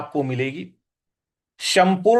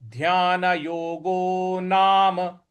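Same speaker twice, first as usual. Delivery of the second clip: chanted Sanskrit, slow and measured; slow recitation; slower than usual. Shown as a demonstration. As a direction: recite slowly and clearly. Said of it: "slow model recitation". (धीमा संस्कृत पाठ)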